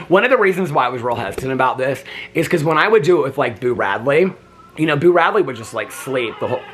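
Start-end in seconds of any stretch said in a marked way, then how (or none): none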